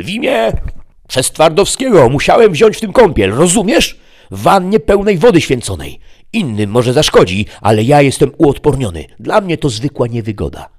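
high, loud outraged devil; a demon furious at betrayal; shrieking realization then attack; Mefisto raging. Acted. Mefisto boasts immunity.

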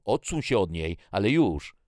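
high, loud command building in force; angry shout. A narrator's reading. Jackie protecting her sister from the man, commanding shout. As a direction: yell at him to back off. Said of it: "krzyknęła Jackie".

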